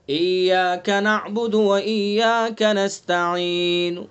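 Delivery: slow tajweed recitation with the last vowel partly voiced; Quranic recitation. Shown as a demonstration. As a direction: recite ایاک نعبد و ایاک نستعین slowly in tajweed style. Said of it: "جیسے مثال کے طور پر".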